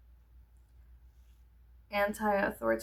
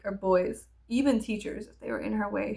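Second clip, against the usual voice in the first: ordinary delivery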